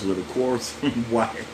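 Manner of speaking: can't hold the laugh reading it; laughing through the verse. Real, not acted. Pastor reading about quarrelsome wives, laughing. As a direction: chuckle breaking into "wife". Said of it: "why I'm laughing at that".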